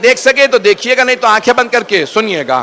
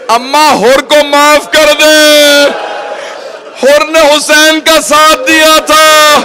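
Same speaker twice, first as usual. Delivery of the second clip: tearful plea; 5 semitones higher, much slower than usual. Acted. Zainab pleading with her mother for Hurr, tearful cry. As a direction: plead to mother in a drawn-out wail.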